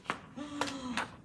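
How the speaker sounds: sharp surprised gasp; a quick startled gasp. Acted. Crowd surprised.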